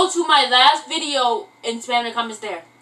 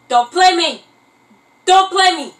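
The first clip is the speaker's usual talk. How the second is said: raised, punchy warning; loud mock challenge; 4 semitones higher, 9 dB louder than usual. Real, not acted. Vlogger answering viewers who doubt the singing, loud challenge. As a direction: throw it at them loudly, twice.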